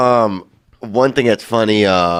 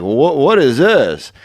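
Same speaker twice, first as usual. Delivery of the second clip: Norm's puzzled question; 4 semitones higher, slower than usual. Retold or imitated. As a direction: ask it slowly, as if baffled by a door. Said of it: "he'll be like".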